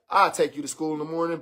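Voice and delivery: low, soft, quick giving in; a low, quick soft give-in. Retold or imitated. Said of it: "broke down and been like".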